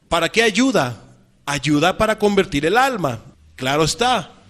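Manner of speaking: loud, ringing scripture proclamation; forceful preaching. Acted. Preacher booming God's law out to the congregation. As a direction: proclaim the verse to the whole hall.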